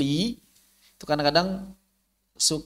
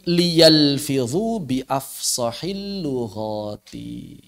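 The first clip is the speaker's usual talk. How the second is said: slow recitation; much slower than usual. Shown as a demonstration. Teacher quoting Imam Ibnu Jazari, slow recited Arabic. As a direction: draw out the Arabic verse slowly.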